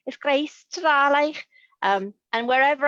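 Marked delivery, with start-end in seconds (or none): 0.1-1.4 slow, higher Scouse accent phrase; put-on Scouse accent. Shown as a demonstration. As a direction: show off a broad Liverpool Scouse accent. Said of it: "and I can put on one".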